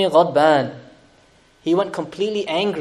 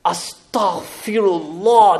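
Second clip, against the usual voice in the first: ordinary delivery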